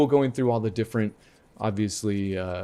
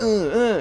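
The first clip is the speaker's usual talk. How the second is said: two short, higher-pitched effort grunts; imitated jumping grunts; 11 semitones higher, 4 dB louder than usual. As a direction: grunt like a game character hopping. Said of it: "when they're jumping or hopping, like".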